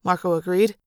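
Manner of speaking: plain reading voice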